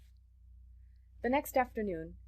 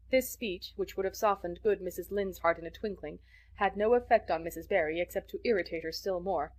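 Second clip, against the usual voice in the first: plain reading voice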